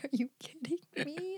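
hushed, toneless disbelief; whisper. Real, not acted. To her friend who rushed her, whispering. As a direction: breathe it out in a whisper.

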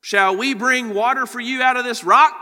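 loud, angry rebuke; shouting at the rebels in frustration; frustrated Moses. Acted. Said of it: "I read it like that because".

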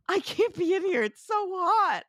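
hammed-up suffering whine; whiny camera operator. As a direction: whine like a man cooking in a box. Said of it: "It's like".

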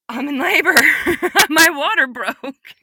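loud, high exclamation; big, high burst of alarmed amazement; retold shock. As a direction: shout the astonished realization, reliving it. Reliving realizing she is in labor.

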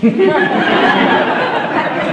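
high, bubbling laughter; real laugh. Real, not acted. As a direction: laugh at your own aside. Laughing at "Pat is alive.

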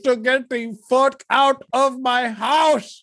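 yelling everyone out of the house; angry shout. Acted. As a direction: shout them out the door.

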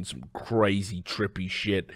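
ordinary delivery

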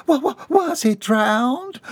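high, slow stammer; stammering fear. A narrator's reading. Bobby Sue hearing of the bathtub, high stammer. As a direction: stumble over it in alarm.

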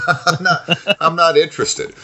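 laughing through the words, pitched high. Real, not acted. Friend laughs off the Seattle sightseeing, laughing. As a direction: turn down the tour while cracking up.

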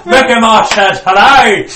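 high, loud taunt through laughter; laughing taunt. Acted. Friend repeating the hitman taunt, laughing and loud. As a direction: cackle the line as you deliver it.

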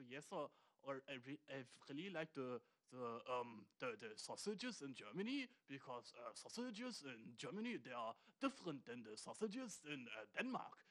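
put-on lightly accented tourist English, low and mild; Danish tourist English. Imitates a Danish tourist in a German shop, mild accent. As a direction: mumble along like a polite Danish tourist. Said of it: "redest so".

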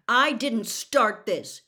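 slow, stubborn and loud. A narrator's reading. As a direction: dig your heels in. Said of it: "Harry said stubbornly".

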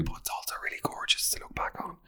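hushed guilty whisper. Real, not acted. Whispering a guilty confession to listeners. Whispered aside about paparazzi era.

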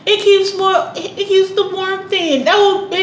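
high whiny mock voice of the ex. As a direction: whine it like a petty ex. Mocking her ex's voice.

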